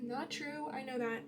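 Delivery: normal talking voice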